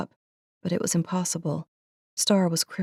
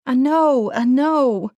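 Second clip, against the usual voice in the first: loud, high anguished I know; anguished cry; 7 semitones higher, 6 dB louder, slower than usual. A narrator's reading. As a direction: cry out in pain, refusing it. Anguished reply.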